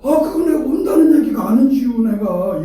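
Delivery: high, loud protest easing at the end; mimicked denial. Preacher mimics no-show explaining away their promise, high protesting denial. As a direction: backpedal indignantly in mock protest. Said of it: "전혀 다른 얘기를 합니다".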